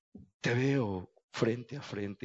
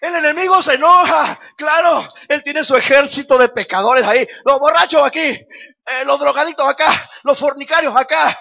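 loud, high, fiery sermon; full-force preaching; 13 semitones higher, 16 dB louder than usual. Acted. Pastor warning the congregation about Satan, fiery shouting. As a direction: hammer it home against Satan.

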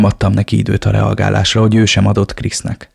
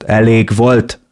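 yelled; much slower than usual. A narrator's reading. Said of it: "üvöltötte".

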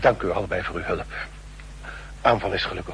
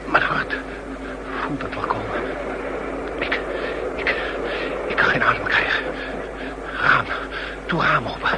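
gasping, breathless pleas for air, partly voiceless; a man faking a heart attack, short of breath; faked heart attack; 6 semitones higher than usual. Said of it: "een gesimuleerde hartaanval".

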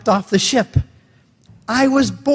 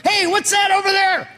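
high, excited call across the water; shouting to a far-off raft; mock yell; 11 semitones higher than usual. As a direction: call out to the next raft, pitched up. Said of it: "So I yell to the raft".

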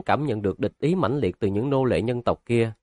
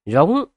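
a raised, bellowing roar of hatred; resentful roar; 5 semitones higher, 5 dB louder than usual. Acted. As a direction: roar it out with bitter rage. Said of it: "phát ra tiếng rống uất hận".

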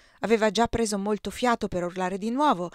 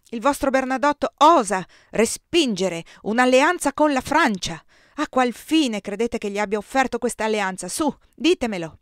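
loud, higher furious outburst; furious emperor; 5 semitones higher, 9 dB louder than usual. A narrator's reading. Emperor raging at Eugenia about Bernadotte, loud fury. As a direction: rage at her over the alliance. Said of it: "si infuriò davvero".

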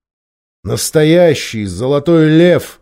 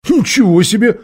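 higher, louder burst of indignation; indignant; 9 semitones higher, 5 dB louder than usual. A narrator's reading. Eustace answering the dwarf's warning, indignant. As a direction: protest with raised pitch. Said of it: "возмутился Юстас".

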